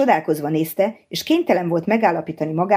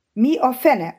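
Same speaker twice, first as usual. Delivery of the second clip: higher, startled cry of wonder; surprised exclamation; 7 semitones higher, slower than usual. A narrator's reading. Pete exclaims in surprise at the clicking discs, astonished. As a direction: blurt it out in amazement. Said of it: "akart felkiáltani csodálkozva Pete".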